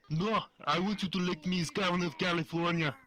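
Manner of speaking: Arnold voice bleeding into Kermit; put-on Austrian strongman that slips toward Kermit; Arnold impression. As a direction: do a shaky-likeness Arnold political speech. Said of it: "what's your Arnold?".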